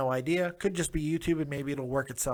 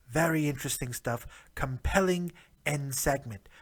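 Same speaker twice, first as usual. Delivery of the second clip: put-on British accent for the whole recap; a jokey British accent, tea and crumpets style. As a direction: play a proper British bloke reviewing wrestling. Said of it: "Thank you, British version of me".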